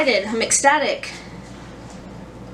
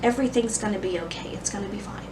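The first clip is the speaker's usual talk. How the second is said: soothing husband; 8 dB quieter, faster than usual. Retold or imitated. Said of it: "And Kevin's just like".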